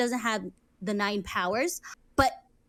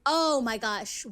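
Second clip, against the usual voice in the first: usual speaking voice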